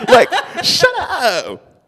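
drawn-out, high, loud shut-up; hammed-up exasperated shriek; laughing shout. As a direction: stretch the yell through laughter. Laughing heckle amid slide jokes.

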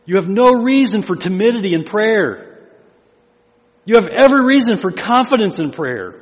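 preaching at full force. Acted. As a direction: rouse the congregation to confidence.

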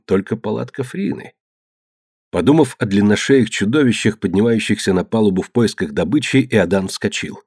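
ordinary delivery, nothing marked

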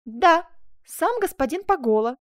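offended and drawn out; stung confirmation. A narrator's reading. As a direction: confirm it pointedly, as if slighted. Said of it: "задетая презрительным тоном Челлини".

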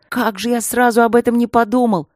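high, loud excited cry; excited exclamation. Acted. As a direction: burst out with a sudden idea. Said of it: "воскликнул он".

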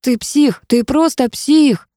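high, slow shouted accusation; shouting. A narrator's reading. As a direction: yell it at a reckless friend. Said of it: "проорал он".

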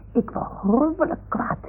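worked-up, indignant cry; acted anger. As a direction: rage like a stamping child. Acted anger at the little house.

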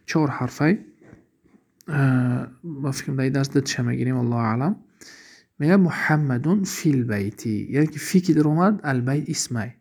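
ordinary delivery

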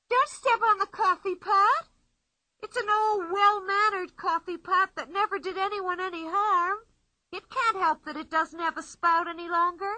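put-on playful girl boasting from inside the tree; Pippi's voice. A narrator's reading.

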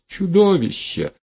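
high outcry with a falling end; anguished, high-pitched insult; outraged insult. A narrator's reading. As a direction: cry it out high, in disgust. Heroine insults the prophet.

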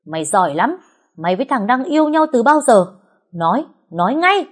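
angry interrogation rising in pitch and force; fierce, high-pitched scolding; roaring angrily. A narrator's reading. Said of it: "gầm lên như hổ dữ".

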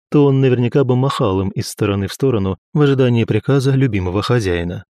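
ordinary delivery, nothing marked

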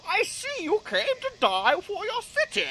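high-pitched villain impression; Bane. Bane addresses an unresponsive Batman, high villain voice. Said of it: "He's like".

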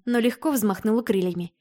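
ordinary delivery

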